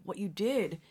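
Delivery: normal talking voice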